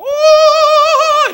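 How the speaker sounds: very high, loud comic "¡Oh!"; the Bible gasping in pretend surprise; mock Bible exclaiming. (la Biblia exclamando, agudo y cómico)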